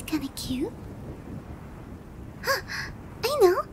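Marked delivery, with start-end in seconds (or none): 3.2-3.6 loud, high burst of realisation; sudden bright idea. Acted. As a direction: exclaim it as the idea strikes. Sudden idea while thinking aloud.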